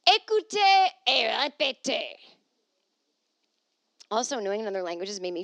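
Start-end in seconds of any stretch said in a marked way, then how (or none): 0.1-2.1 loud, high, slow French instructor; booming schoolroom French drill; French lesson command. Pretend. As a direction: call it out like a language tape. Mimics French language-lesson command.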